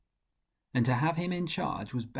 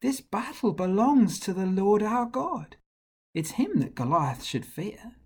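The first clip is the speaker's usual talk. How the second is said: a lightly voiced higher boy; eager, earnest, a bit higher; young David; 8 semitones higher than usual. David tells the king the battle is God's, lightly boyish. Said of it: "He said".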